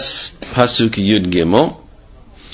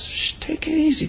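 hushing; 8 dB quieter than usual. Acted.

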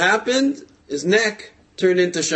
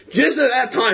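laughing; 10 semitones higher, 4 dB louder than usual. Real, not acted. Laugh heard while ranting.

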